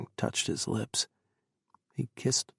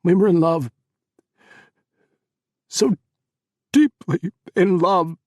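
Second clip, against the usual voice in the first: higher, much louder, crying plea; anguished plea; 7 semitones higher, 11 dB louder than usual. Acted. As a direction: cry out the love. Chris pleads with mother.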